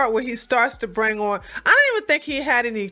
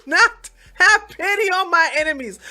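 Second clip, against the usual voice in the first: much higher and louder, declaring no pity; loud rant; 8 semitones higher, 6 dB louder than usual. Real, not acted. Ranting to viewers about Alec, loud and high. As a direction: shout your contempt.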